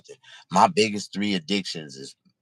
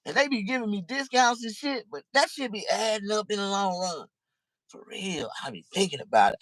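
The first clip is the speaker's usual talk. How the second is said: normal talking voice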